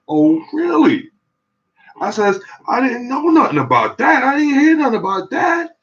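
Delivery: hearing hospitals use silver, hammed-up high disbelief; mock astonishment. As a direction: squeal it up high like you can't believe it. Learning doctors use silver sheets.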